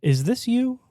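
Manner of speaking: high put-on questioner's voice; questioning employer. Employer confronting worker over podcast, high mock questioning. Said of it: "they're gonna be like".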